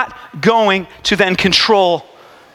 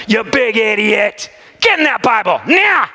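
mock harsh Jesus. Imagined Jesus scolding a believer, mock harsh shout. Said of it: "heard Jesus be like".

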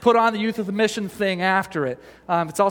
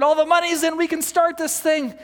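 high, bright, excited proclaiming; the expected triumphant word at the conference, high and excited; triumphant announcement; 9 semitones higher than usual. Retold or imitated. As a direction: sell the big victory.

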